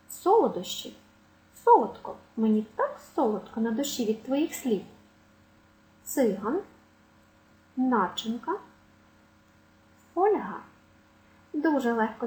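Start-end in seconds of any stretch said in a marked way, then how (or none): 0.1-2.2 СОлодощі, СОлодко, pitched high on СО; first-syllable stress. Shown as a demonstration. Teacher listing nouns, stress on first syllable. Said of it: "мають наголос на першому складі". 6.0-10.6 ЦИган, НАчинка, ФОЛЬга; first-syllable stress. Shown as a demonstration. Teacher listing nouns, stress on first syllable. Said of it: "мають наголос на першому складі".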